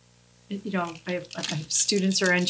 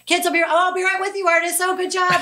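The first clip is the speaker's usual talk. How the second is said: higher, faster distracted classroom voice with a laugh; juggling teacher; 11 semitones higher than usual. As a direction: split attention between phone and kids. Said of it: "I'm like".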